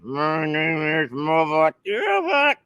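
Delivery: slow, drawn-out robot impression. Dad secretly voicing the lawnmower for his child, slow mock robot voice. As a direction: pretend to be a talking robot, slowly. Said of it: "you think it's the robot talking".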